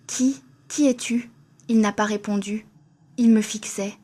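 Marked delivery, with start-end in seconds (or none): 0.1-1.3 slow, stammering frightened question; trembling fear. Acted. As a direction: falter out the words in terror. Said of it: "Je tremblais".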